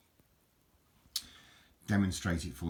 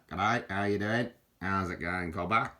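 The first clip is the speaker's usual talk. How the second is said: put-on louder, jokey Australian accent; hammed-up Aussie hello; mock Australian greeting; 4 dB louder than usual. Greeting viewers like an Australian, mock Australian accent.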